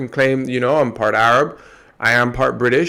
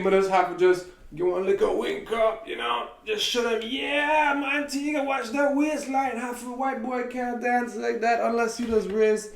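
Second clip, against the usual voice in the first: Antiguan dialect, much higher and softer; 9 semitones higher, 7 dB quieter than usual. Shown as a demonstration. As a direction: slip into thick Antiguan island speech, pitched up and hushed. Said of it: "Show y'all".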